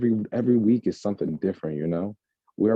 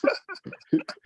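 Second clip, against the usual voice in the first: breathy high-pitched laugh. Real, not acted. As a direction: burst into an airy laugh. Laughs after interruption.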